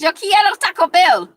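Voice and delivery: put-on high, loud Mexican-accented dog voice. Voicing her dog Bella in a Mexican accent, loud and high. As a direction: belt it as a Mexican-accented Taco Bell dog. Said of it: "Bella with a Mexican accent".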